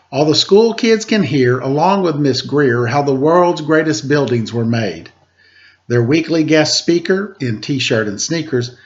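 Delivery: lilting rhymed read-aloud voice; story narration. Acted. Host reading a rhyming picture book to kids, lilting narration. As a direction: read the verse to the children with bounce.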